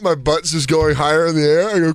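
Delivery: usual speaking voice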